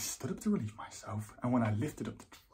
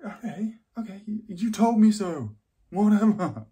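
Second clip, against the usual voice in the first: louder, higher, huffy giving-in; exasperated concession; 8 semitones higher, 10 dB louder than usual. Acted. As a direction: concede irritably to the I-told-you-so. Gives in to eye-rolling friend.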